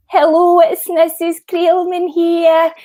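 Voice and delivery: put-on high, loud, slow Scottish accent; repeated Scottish impression. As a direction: redo the Scottish mum voice, drawn right out. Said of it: "they made me repeat the whole thing".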